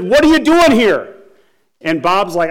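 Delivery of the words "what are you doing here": higher, louder imitated demand; angry yell. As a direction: shout the question like an angry guard. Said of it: "continues to yell at him".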